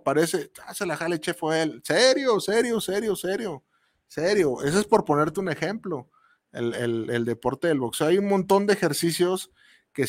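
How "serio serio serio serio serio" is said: repeated incredulous serio, high then dying away; mock disbelief, fading. Co-host teasing chef Joel, mock disbelief fading out. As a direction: tease him with a fading echo of disbelief. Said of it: "¿En serio?".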